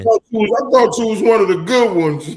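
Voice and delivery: mock betrayed. Mock betrayed plea to Joe.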